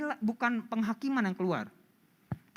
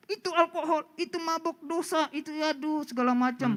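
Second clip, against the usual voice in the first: higher, half-hearted mock yell about sin; 9 semitones higher than usual. Youth leader mocking his own preachy scolding, mild mock yell. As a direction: pretend to rant at the teens. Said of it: "Daripada saya teriak-teriak".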